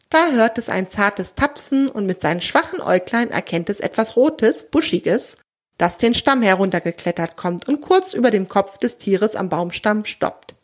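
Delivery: ordinary delivery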